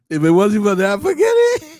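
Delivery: high, loud, breaking into laughter; real laughter. Real, not acted. Host crediting the doctor for his schooling, laughing hard. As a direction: let the words rise into a big laugh. Bursts out laughing at own story.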